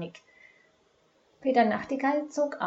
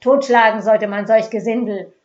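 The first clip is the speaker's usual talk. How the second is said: loud, firm call for violence; a man in the mob calling out loud; loud and clear demand; 13 dB louder than usual. A narrator's reading. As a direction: demand it loudly over the crowd. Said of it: "forderte laut und klar".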